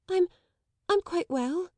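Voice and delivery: put-on high, halting shy girl. A narrator's reading. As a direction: stumble out of shyness. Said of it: "overwhelmed with shyness".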